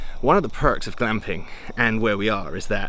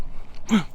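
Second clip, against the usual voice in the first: excited gasp; 11 semitones higher than usual. Real, not acted. Spots her dog Luna.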